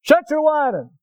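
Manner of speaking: loud, high mock-stern scolding; hammed-up impatient father; gruff dad. Preacher imitating dad scolding injured son, mock-stern. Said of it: "a dad coming along".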